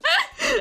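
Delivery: a sudden high giggle that falls away; high squealing laugh. Real, not acted. Streamer cracking up at her own dramatic line, high giggle.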